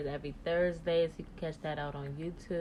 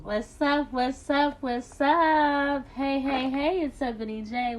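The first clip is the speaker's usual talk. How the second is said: high, loud and bright; bright, vibrant voice; 9 semitones higher, 7 dB louder, slower than usual. Real, not acted. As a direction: greet the crowd with big energy. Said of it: "That bright, vibrant voice".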